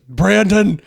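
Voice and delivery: loud, high imitation yell; Jesse Ventura. Co-host imitating the comic's angry impression, loud yell. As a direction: holler the name like a mad wrestler. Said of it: "like, loud Jesse Ventura".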